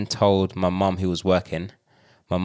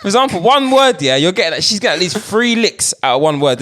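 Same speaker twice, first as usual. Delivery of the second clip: put-on African accent; 11 semitones higher, 4 dB louder than usual. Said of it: "why am I doing African accent".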